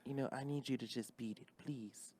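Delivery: hushed, wounded [name]. Pretend.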